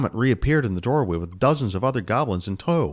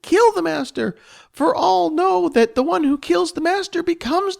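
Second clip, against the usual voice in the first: high squeaky goblin voice, accusing and anguished; a small goblin grieving her dead master, much higher; distraught goblin; 4 dB louder than usual. Acted. Spleef the goblin wails at Jess over the dead master.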